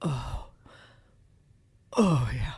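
low, slow mock moans; low-voiced lover moaning. Shown as a demonstration. Demonstrating for co-host who looks away, low mock moaning. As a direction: moan deep down low, as a lover would. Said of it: "Do the noise again".

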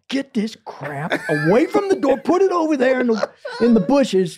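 high-pitched exasperated dad voice. Retold or imitated. Dad ordering the stinking bucket moved, exasperated.

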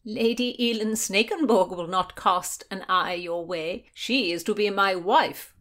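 proud, projected pronouncement; nobly spoken. A narrator's reading. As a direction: stake the claim with noble dignity. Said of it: "was nobly spoken by the Marquis".

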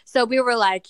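ordinary talk

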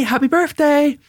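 high-pitched eager birthday hello; overexcited greeting. Retold or imitated. Dad greeting his daughter in the car, high and eager. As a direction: greet her with too much energy.

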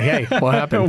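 high puzzled "what happened"; mock surprise. Mock puzzled at team winning.

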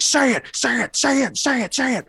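high-pitched rapid-fire badgering; imitated frantic demand. Mimicking the man's frantic demand.

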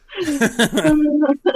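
high loud cackle; burst of laughter. Real, not acted. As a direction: laugh hard at the joke. Laughing at own bit.

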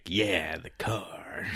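low, whispered mumble; mock whisper. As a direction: murmur it like an overheard voice. Mimicking muffled overheard line.

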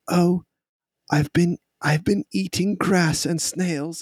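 put-on high, bright-eyed little kid boasting; small child. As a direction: play an eager small child, pitched well up. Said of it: "this small child says".

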